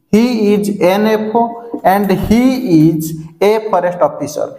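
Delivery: very slow, word-by-word teaching delivery; slow model sentence. Shown as a demonstration. Teacher contrasting an and a for the class, slow model sentence. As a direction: spell it out slowly for learners.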